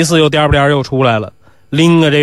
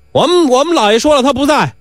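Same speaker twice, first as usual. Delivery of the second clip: calling out; 11 semitones higher than usual. Acted. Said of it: "门搭子对外面喊".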